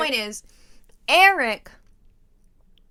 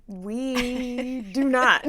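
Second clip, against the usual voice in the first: very slow, deliberate mock reply; imitated slow-motion refusal; drawn-out denial; much slower than usual. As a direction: stretch each word out as the unimpressed friends. Retelling friends' deadpan answer.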